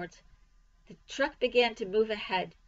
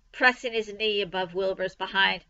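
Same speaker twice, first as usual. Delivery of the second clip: plain reading voice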